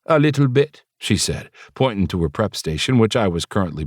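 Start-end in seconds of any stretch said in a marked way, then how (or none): 0.1-0.8 put-on Jamaican accent. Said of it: "her accent similar to my mother's".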